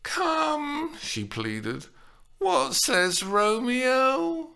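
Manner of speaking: high, slow pleading coax; appeasing tone. A narrator's reading. Juliet pleads appeasingly with her nurse. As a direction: plead sweetly. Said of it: "appeasing tone".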